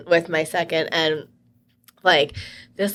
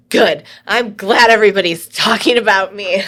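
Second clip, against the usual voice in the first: loud, pointed mock-gladness; forceful sarcastic retort, re-enacted; retold angry comeback; 3 semitones higher, 8 dB louder than usual. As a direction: throw it back loudly, feigning gladness. Retold angry comeback to rude realtor.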